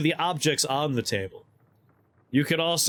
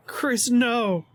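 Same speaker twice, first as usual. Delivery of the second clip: high, raised cry of objection; scolding protest; 10 semitones higher than usual. Real, not acted. Streamer scolds Chris in the chat, scolding protest. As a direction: cut them off with a much higher no.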